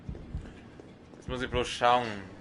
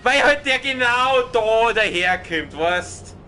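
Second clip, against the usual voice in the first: agitated; 9 semitones higher, 6 dB louder, slower than usual. Real, not acted. Complaining after hit.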